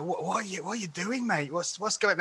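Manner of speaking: soft, baffled muttering at the screen; puzzled quiet mutter. Retold or imitated. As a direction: quietly ask what on earth he is doing. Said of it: "and you're like".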